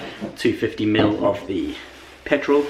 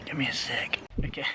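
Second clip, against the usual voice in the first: whispering; 3 semitones higher, 12 dB quieter than usual. Real, not acted. Whispers while cleaning spill.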